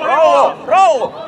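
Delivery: loud, urgent shout across the pitch; loud call. Real, not acted. Coach calling out to a player on the pitch, loud shout. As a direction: call it out hard, twice.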